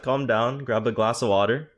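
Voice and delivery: ordinary delivery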